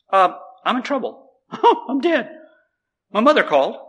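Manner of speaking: high-pitched mock panic; hammed-up frightened phone call; imitating scared student. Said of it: "scared to death".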